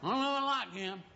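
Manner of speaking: a hint of a sour churchgoer, chuckling at himself; grumbling congregant. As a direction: grumble your doubts about the new guy, half to yourself. Grumbling congregant in his pew.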